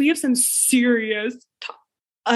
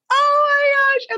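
high, slow, loud squeal; overwhelmed; 4 dB louder, slower than usual. Acted. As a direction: gasp it out in awe. Overwhelmed by the reveal.

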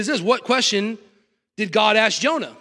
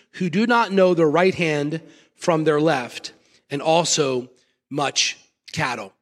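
lower, measured Bible reading voice; scripture reading; 6 semitones lower than usual. Acted. Pastor reads scripture passage.

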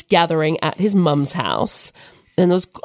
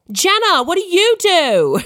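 higher, loud-ish American calling across a room; American accent; 11 semitones higher, 3 dB louder, slower than usual. Retold or imitated. American family friend calling across the room, American accent. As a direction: mimic a brash American asking across the room. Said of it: "my accent's terrible".

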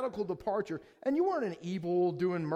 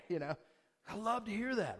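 normal talking voice

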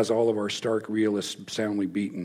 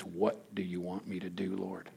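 hushed surrender; 4 semitones lower, 14 dB quieter than usual. Retold or imitated. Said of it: "he says".

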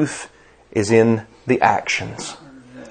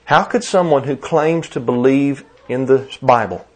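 normal talking voice

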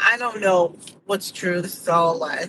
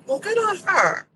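approving; 11 semitones higher than usual. Retold or imitated. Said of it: "and I was like".

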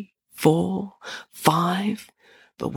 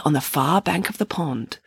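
ordinary delivery

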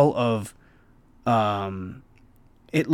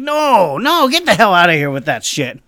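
loud, higher shove-off; mock chefs' rejection; 5 semitones higher, 5 dB louder than usual. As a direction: bark them away. Chefs refusing the peanuts.

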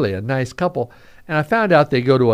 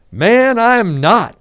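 loud, higher-pitched denial; defiant denial; 12 semitones higher, 8 dB louder, slower than usual. Acted. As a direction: refute it firmly and loudly. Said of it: "Very defiantly, very profoundly saying".

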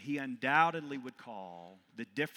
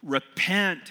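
a high, loud thundered command; thundering; 7 semitones higher, 10 dB louder than usual. Acted. Prophet thundering at the congregation, high and loud. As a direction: thunder it down at the whole crowd. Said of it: "he thunders to all of us".

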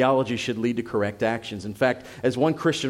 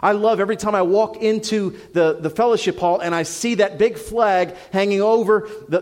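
raised, louder, driving pulpit voice; fiery preaching; 5 semitones higher, 3 dB louder than usual. Acted. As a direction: preach it hard, building to the conviction. Pastor preaching at full force.

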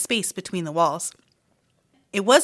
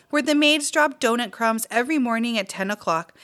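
plain reading voice

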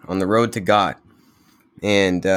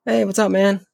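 cheerful dancing man; 12 semitones higher than usual. Retold or imitated. Dancing man greets the returning monk.

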